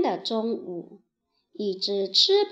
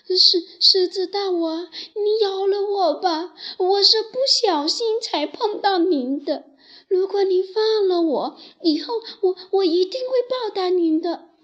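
higher, louder begging mouse voice; pleading; 8 semitones higher, 4 dB louder than usual. Acted. Caught mouse begs the lion to spare him. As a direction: beg for your life, small and desperate. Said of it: "苦苦地哀求道".

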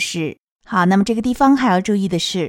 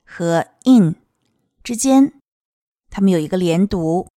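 ordinary talk, nothing marked

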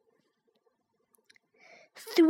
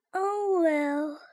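yawning; 2 semitones higher, 6 dB quieter than usual. A narrator's reading. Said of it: "she yawned".

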